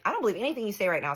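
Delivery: fast, high-pitched comeback; mock-indignant rapid retort; retold indignant retort. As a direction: fire back at him in a rush. Retold quarrel with partner.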